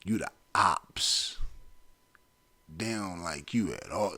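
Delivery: usual speaking voice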